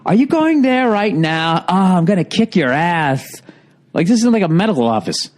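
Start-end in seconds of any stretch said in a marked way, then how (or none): 0.0-3.4 slow, drawn-out imitation of a girl complaining; mock a young receptionist, stretching the words; exaggerated phone whine, dragged out. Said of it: "the young girl gets on the phone".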